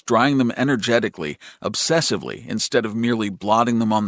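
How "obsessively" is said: usual speaking voice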